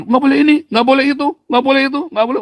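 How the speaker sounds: high-pitched mock scolding, rattled off; nagging parent. Mimics parent's endless prohibitions.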